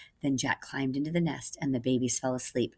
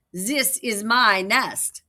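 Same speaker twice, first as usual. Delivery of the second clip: possessive; 5 semitones higher, 6 dB louder, much slower than usual. Acted. Birds quarrel over nest.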